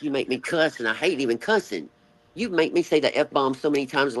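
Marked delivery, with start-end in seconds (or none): none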